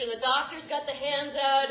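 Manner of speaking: ordinary talk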